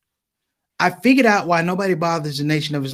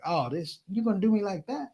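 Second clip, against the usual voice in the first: much softer, hurt complaint; deflated aside; 3 semitones higher, 10 dB quieter than usual. Retold or imitated. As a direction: act let down, saying it under your voice. Nobody answers his call.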